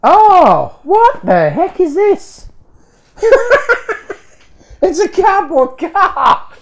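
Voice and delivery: high, loud astonished exclaiming; genuinely floored by a mystery parcel; stunned surprise. Real, not acted. Opening a fan's parcel and finding a cardboard car, loud astonished surprise.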